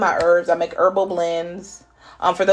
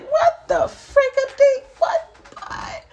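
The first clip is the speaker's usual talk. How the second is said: high, laughing and amazed; laughing surprise; slower than usual. Real, not acted. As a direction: burst out laughing in disbelief.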